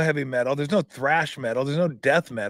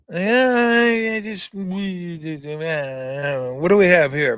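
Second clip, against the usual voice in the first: mock clueless designer; 4 semitones higher, much slower than usual. Acted designer meeting.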